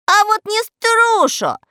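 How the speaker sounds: high, loud squeaky cry; defiant shout. A narrator's reading. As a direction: yell back stubbornly. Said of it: "кричал Мурзилка". (упрямо кричит тонким голоском)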